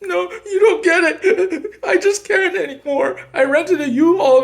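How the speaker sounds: very high mock-tearful falsetto; imitating ex-wife. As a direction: wail it like a hammed-up, upset wife. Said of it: "And then she's just like".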